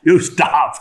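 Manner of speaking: loud, high, slow mock outburst; hammed-up indignant protest; upset robber. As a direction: play an exasperated robber, each word pushed out. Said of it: "Ratnaker is getting really upset now".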